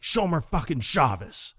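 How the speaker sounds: lightly acted angry complaint; mock angry grumble. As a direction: imitate someone fuming. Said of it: "like, angrily".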